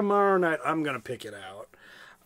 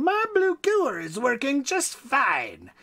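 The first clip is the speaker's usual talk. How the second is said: Van Itch yelling; 8 semitones higher, slower than usual. Acted. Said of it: "he yelled".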